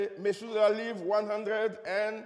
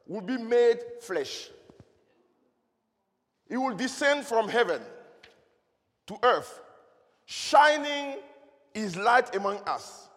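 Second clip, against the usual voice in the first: preaching. Acted. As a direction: proclaim each phrase like scripture. Preaching the hidden sentence.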